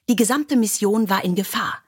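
plain reading voice

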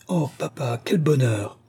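put-on thrilled girl crying out to her father; delighted exclamation. A narrator's reading. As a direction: exclaim with a daughter's joy. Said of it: "s'écria Mila".

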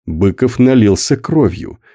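plain reading voice

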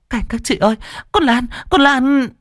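high, stammering cry for help; panicked call. Acted. Hằng calling the neighbours for help, panicked. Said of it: "Hằng kinh hãi".

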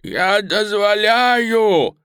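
high, loud, very drawn-out call; old man's feeble cry. Acted. Old hostage calls from window, crying out high. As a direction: strain to carry a long cry outside. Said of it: "слабо кричал старик".

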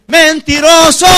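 loud, high, drawn-out accusation; God calling down. Acted. The Lord answers the singers from above, shouted accusingly. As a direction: call it out from on high. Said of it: "el Señor desde arriba dice".

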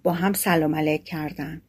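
plain reading voice

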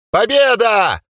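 high, jubilant yell; elf yelling. Acted. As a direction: shout it in triumph. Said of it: "орал эльф".

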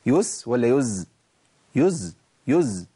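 slow يس against buzzing يز; contrast س and ز. Shown as a demonstration. Teacher asks students to listen closely. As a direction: draw out س then voiced ز. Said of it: "اسمعوا كويس".

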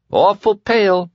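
high, loud sing-song taunt; mocking tease. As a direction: needle him with a grin. Larry mocks Ratty, grinning.

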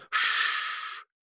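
soft, long anxious shushing; long hushing hiss. A narrator's reading. Rabbit silencing Alice nervously, hissing. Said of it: "зашипел кролик, тревожно оглядываясь".

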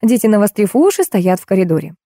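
plain reading voice

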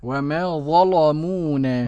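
slow "وما ظلمونا" with dark ل; thickened lam. Shown as a demonstration. Student repeats Warsh's reading, heavy ل in "وما ظلمونا". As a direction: echo "ظلمونا", making the ل heavy. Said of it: "بتغليظ اللام".

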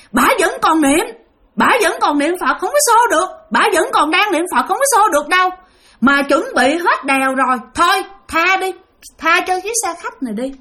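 high, insistent ghost restraining the others; another ghost voice. Retold or imitated. Second ghost telling the others to spare the bus, high and firm. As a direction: hold them back, high and firm. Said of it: "một giọng nói khác lại nói rằng".

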